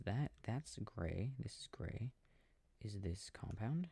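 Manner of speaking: ordinary talk, nothing marked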